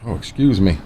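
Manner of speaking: talking through hiccups; interrupted by a hiccup. Real, not acted. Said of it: "I got the hiccups".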